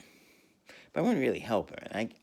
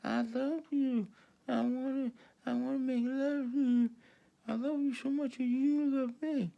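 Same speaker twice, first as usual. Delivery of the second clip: girl's voice impression. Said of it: "the one girl talks like this".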